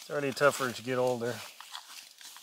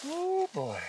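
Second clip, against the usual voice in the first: exclaiming. Real, not acted. Reacts while changing in cold.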